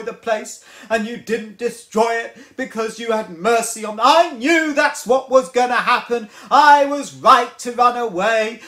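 whiny, rising to a loud self-pitying rant; Jonah complaining. Retold or imitated. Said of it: "And he goes".

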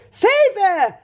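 high, loud plea; desperate cry. A narrator's reading. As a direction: cry out for your child's rescue. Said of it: "cried Missus Beauregard".

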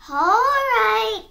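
high, loud, drawn-out giving in; storybook sigh. A narrator's reading. Piglet giving in to the fox, sighing. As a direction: draw it out in surrender. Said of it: "sighed the piglet".

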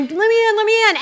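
imitating a guy banging on the door to get in; pleading to be let in, lightly acted; mock pounding-on-door plea. Someone banging on bathroom door.

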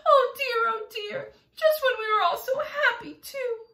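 high-pitched tearful wail; distressed cry. A narrator's reading. Ladybug mourns her lost friends, wailing. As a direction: lament it high, near tears. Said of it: "cried the ladybug".

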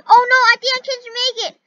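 high, loud fast panicked cries; frightened screaming. Real, not acted. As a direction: shriek in fear. Escaping danger, screaming.

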